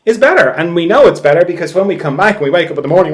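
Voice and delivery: normal talking voice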